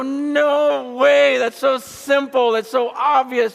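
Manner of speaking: very high, loud mock disbelief; retold astonishment at full pitch; amazed. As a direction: exclaim it high and drawn out, reliving the revelation. Retold amazed reaction in car.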